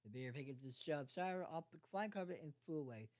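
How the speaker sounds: ordinary delivery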